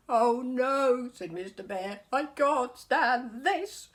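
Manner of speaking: loud, high, slow wail; exhausted loud complaint; at wits' end. Acted. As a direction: whine it out, utterly fed up. Mister Bear exhausted, at wits' end.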